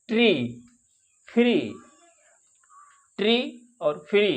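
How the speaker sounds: ट्री and फ्री with a long stretched ee; long ee sound. Shown as a demonstration. Teacher showing students the vowel: long ee demo. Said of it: "इसमें long ई की आवाज़ निकलती है".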